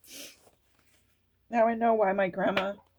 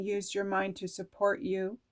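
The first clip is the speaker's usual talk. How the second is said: ordinary delivery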